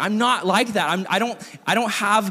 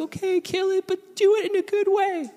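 much higher put-on voice for his wife; Brooklyn's voice. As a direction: imitate her high and pleading. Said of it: "So Brooklyn's like".

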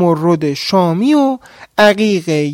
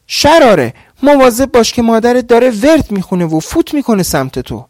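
loud, high, fast urgent cry; sudden warning shout; 7 semitones higher, 3 dB louder than usual. Acted. Husband shouting a warning to his wife about her mother's spell, loud and fast. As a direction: yell the warning before it's too late. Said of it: "ناگهان فریاد کشید".

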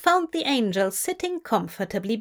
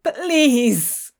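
higher, anguished begging; sobbed plea; 5 semitones higher, 4 dB louder than usual. A narrator's reading. Crowley begging the archangel for Zira, tearful. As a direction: beg through a sob. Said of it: "Crowley sobbed".

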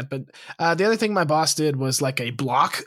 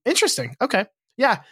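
ordinary talk

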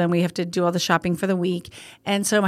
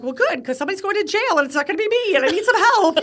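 urgent exasperation; 10 semitones higher than usual. Retold or imitated. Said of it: "I'm like".

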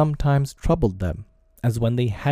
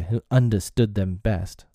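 usual speaking voice

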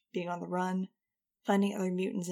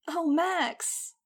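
much higher, louder character voice; 9 semitones higher, 5 dB louder than usual. A narrator's reading. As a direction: gush it in a high voice. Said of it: "Nudge said".

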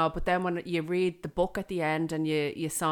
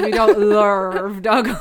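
laughing silly voice; 4 semitones higher, 13 dB louder, slower than usual. Acted.

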